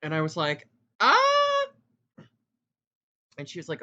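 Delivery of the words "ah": high, loud startled shriek; startled yelp. Retold or imitated. His shocked reaction to the coworker's comment, startled yelp. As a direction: let out a sudden high yelp of alarm.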